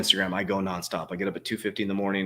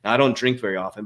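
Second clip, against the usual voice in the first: ordinary talk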